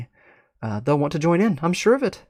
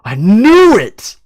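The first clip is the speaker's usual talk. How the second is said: much higher, loud and slow; triumphant shout; 9 semitones higher, 13 dB louder, much slower than usual. Acted. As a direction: shout it like a vindicated conspiracy theorist. Term excited at the revelation.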